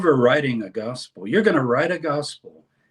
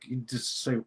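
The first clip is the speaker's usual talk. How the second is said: ordinary talk, nothing marked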